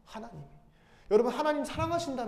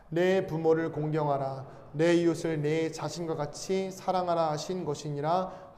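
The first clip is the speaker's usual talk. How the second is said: slow, measured recitation of scripture; scripture reading; 4 semitones lower, slower than usual. Acted. Pastor leading the congregation in a slow scripture reading. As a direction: read it aloud slowly, like reciting the Bible together.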